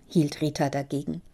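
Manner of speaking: ordinary delivery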